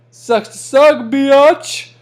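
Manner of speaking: mocking taunt. Real, not acted. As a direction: rub it in, loud and cocky. Gloating at a losing player.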